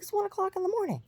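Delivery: very high, fast imitated outburst; exasperated exclamation. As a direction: mimic someone protesting, voice shooting way up. Retold exclamation in quarrel.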